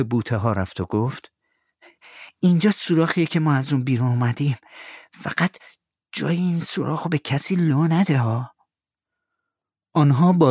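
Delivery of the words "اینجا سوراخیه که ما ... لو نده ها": put-on higher eager boy; Tom's boyish voice. Tom asks Huck to help find the money, eager. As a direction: sound young and keen.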